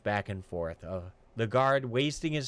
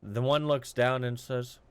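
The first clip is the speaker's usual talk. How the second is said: usual speaking voice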